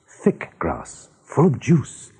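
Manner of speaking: ordinary delivery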